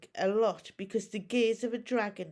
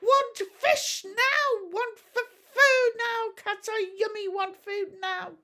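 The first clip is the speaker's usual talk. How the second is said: very high, loud, slow greedy dragon chant; shouting dragon; 13 semitones higher, 6 dB louder than usual. A narrator's reading. Struggling dragon shouting at Hiccup for food. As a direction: demand food like a furious little beast.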